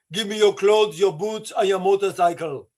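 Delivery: lightly voiced action-film demand; mock movie tough guy. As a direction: deliver it as a hinted film impression. Quotes film villain demanding belongings.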